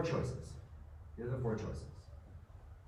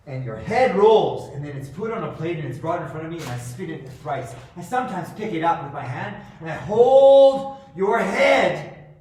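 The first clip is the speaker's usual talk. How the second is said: louder and higher, savouring every word; ranting loudly about heads; gloating rant; 8 semitones higher, 16 dB louder than usual. Real, not acted. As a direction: relish the gruesome detail. Gloating over beheading.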